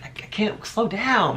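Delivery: a lightly voiced old grandma, slowed down; grandma's plea, half-acted; imitating grandma. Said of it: "my grandma's like".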